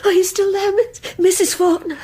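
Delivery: calling out, afraid she has gone; anxious call. Acted. Calling to silent listener.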